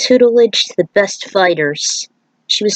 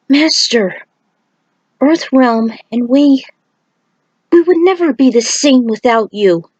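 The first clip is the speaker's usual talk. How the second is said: a touch higher, pleading farewell; grieving student; 4 semitones higher than usual. Acted. Student bidding master farewell, mildly tearful. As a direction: hold back tears, lightly voiced.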